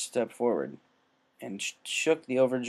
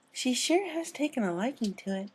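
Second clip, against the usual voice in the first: much higher, timid pony voice; 12 semitones higher than usual. A narrator's reading. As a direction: murmur it sweetly from the shore. Fluttershy voiced.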